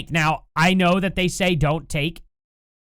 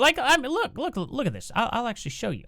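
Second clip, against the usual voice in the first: ordinary talk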